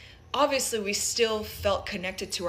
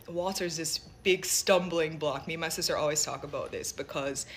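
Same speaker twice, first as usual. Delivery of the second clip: usual speaking voice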